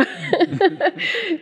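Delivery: a high, breaking laugh through tears; teary laugh. Real, not acted. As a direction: laugh off the welling emotion. Emotional laugh at teary moment.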